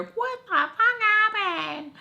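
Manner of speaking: high, drawn-out mock scream; retold screaming. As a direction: imitate a man screaming down the phone. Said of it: "So he's screaming".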